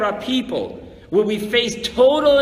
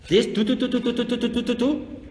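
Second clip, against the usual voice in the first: shofar imitation; 3 semitones higher, faster than usual. Mimics shofar blasts again.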